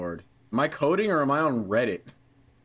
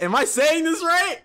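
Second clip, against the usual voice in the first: ordinary talk, nothing marked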